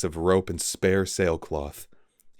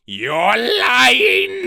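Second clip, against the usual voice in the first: high, loud screaming denial; cornered traitor screaming at the captain; 10 dB louder than usual. A narrator's reading. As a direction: shriek it in panic. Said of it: "Spleeth screamed".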